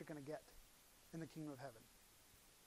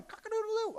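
rooster crow; 14 semitones higher, 5 dB louder than usual. Retold or imitated. Imitating the rooster.

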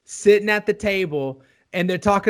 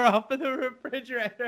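usual speaking voice

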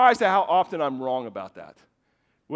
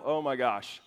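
low, softer mock-weary parent; acted exasperated aside, dropped low; eye-rolling exasperation; 5 semitones lower, 4 dB quieter, slower than usual. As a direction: imitate a parent's eye roll in the voice. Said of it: "response nearly every time we see this is an eye roll".

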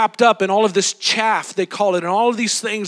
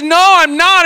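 panicked denial; 8 semitones higher, 6 dB louder than usual. Retold or imitated. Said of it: "And he says".

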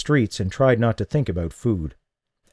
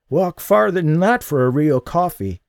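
put-on old man; 8 semitones higher, 3 dB louder than usual. Old man voiced in dialogue.

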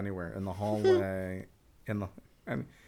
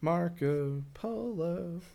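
playful call; 8 semitones higher, much slower than usual. Pretend. Calling out like the game.

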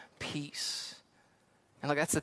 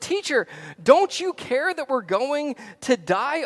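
loud, high, drawn-out plea; losing their minds; 9 semitones higher, 7 dB louder than usual. Acted. Disciples waking Jesus in the storm, panicked shouting. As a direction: cry out in acted alarm, slowly. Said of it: "they are losing their minds".

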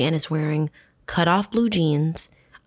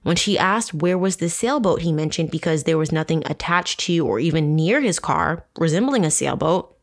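normal talking voice

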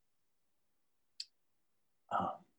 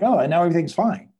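mock everything-is-fine chirp, high and quick; put-on upbeat denial, rushed and raised; pretended cheerfulness; 7 semitones higher, 6 dB louder, faster than usual. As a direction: fake a breezy, loud reassurance. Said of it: "we're not pretending like".

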